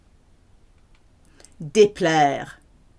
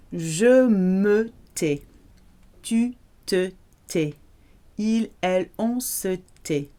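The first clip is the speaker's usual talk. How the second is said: slow, careful drill of the phrase; teacher modelling slowly; slow model. Shown as a demonstration. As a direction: spell it out slowly for learners. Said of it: "Let's look at the pronominal verb".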